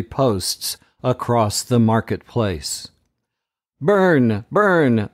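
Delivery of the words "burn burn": crowd's cry. A narrator's reading. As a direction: chant it like an angry mob. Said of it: "the crowd still cries".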